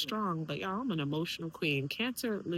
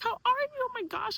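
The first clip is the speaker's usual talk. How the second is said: high, gushing mock-friend hello; excited greeting. College friend happy to see her, imitated high and excited. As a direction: greet an old friend with a delighted high lift.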